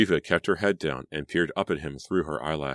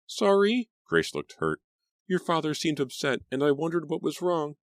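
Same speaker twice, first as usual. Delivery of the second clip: put-on much higher, meek hurt girl; timid Grace voice; 12 semitones higher than usual. A narrator's reading. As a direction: apologise like a little mouse.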